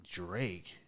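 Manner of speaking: soft, low single word; low-key, subdued query; quiet puzzled reply. Retold or imitated. His own puzzled reply, first take.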